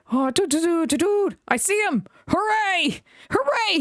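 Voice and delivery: high excited cheers; cheering. A narrator's reading. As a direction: cheer from the hilltop. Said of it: "he cheered".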